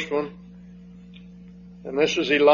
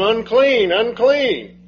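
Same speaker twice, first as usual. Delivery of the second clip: mock cry of a leper, a touch louder; 7 semitones higher, 4 dB louder, much slower than usual. Preacher voicing the leper crying out his warning, mildly raised. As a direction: call it out like the outcast leper. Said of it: "He's crying out all the time".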